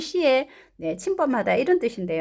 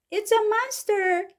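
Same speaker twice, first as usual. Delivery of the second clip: high-pitched, slow exclamation; drawn-out character alarm; alarmed story mother; 10 semitones higher, much slower than usual. Acted. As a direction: cry out as a frightened storybook mum. Mrs. Blair's alarm in story.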